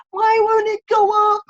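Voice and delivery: complaining to everybody that her follower count is stuck, whined high. Retold or imitated. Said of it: "I was complaining to everybody".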